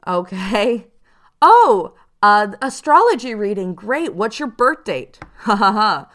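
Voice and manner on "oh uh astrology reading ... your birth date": much higher, much louder gushing "oh"; hammed-up bubbly scammer; chirpy online scammer fishing for a birth date; fake eager scammer. Mock scammer asking birth date.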